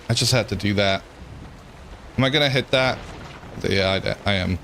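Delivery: ordinary talk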